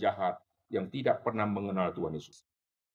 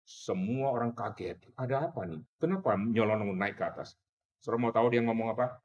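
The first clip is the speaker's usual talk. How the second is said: usual speaking voice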